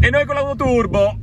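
loud, high-pitched gleeful shout; excited call-out. Real, not acted. Joking about their own turbo car, excited call-out. Calling out amid cars, then laughs.

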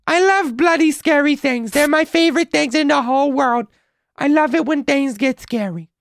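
very high put-on character voice; silly falsetto, held all through; Timmy voice. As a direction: squeak it out in falsetto. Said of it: "That's my Timmy voice".

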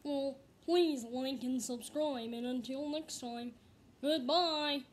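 slow, loud frog puppet voice; Kermit the Frog voice. Pretend. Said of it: "let's hear your, uh, best Kermit the Frog voice".

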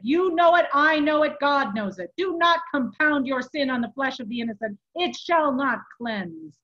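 loud, emphatic preacher-like condemnation; angry rebuke. A narrator's reading. Furious monk warns the abbot off the innocent, angry rebuke. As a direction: drive each clause home forcefully.

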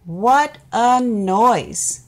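slow, loud, drawn-out wonder; amazed exclamation. A narrator's reading. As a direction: stretch it out in amazement. Loud drum noise.

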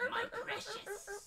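laughing through a high creature impression; My Precious creature. Asking to be the little guy, high creature voice. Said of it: "that little guy who goes".

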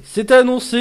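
loud, high, elated exclamation; excited triumph. Real, not acted. Streamer whose prediction came true, excited triumph. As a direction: crow it out loudly.

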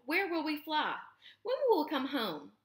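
high-pitched little gosling asking; youngster's high, wondering voice; curious gosling. A narrator's reading. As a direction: pipe up with high young questions.

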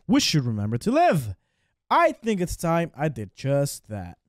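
Irish lilt, half-attempted; lightly done Irish accent. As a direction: play the Irish worker, hamming the brogue a bit. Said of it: "Sean Flynn. Such an Irish name".

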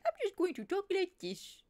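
put-on creature voice, starting high; Mook. Shown as a demonstration. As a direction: show off a silly mooing-creature voice. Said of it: "I was just like".

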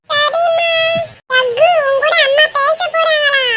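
put-on very high little-kid voice; high childish sing-out; child on phone. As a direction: pass on the message in a squeaky child's voice. Child Meenu passes phone on.

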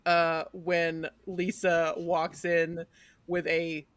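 ordinary talk

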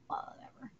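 breathy aside under the breath; whisper. Real, not acted. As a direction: whisper it to yourself. Muttered aside while reading.

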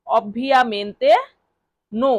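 a long pause between the two words. Shown as a demonstration. Teacher splitting the phrase for learners, word by word. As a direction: set each word apart. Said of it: "এটা Italian ভাষায় বলতে হবে".

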